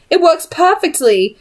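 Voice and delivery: insist, a little high and defensive; protesting. Acted. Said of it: "Charlotte protested".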